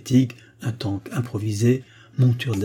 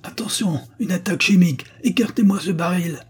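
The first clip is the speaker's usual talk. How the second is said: panicked shout; 9 semitones higher than usual. A narrator's reading. Carruthers warns his commando in panic through the megaphone, high and shrieking. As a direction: scream a warning in panic. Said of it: "sa voix déformée par la panique".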